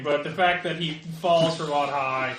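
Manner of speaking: usual speaking voice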